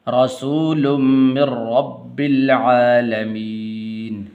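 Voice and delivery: ordinary delivery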